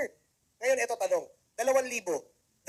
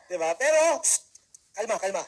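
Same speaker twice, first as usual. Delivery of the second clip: usual speaking voice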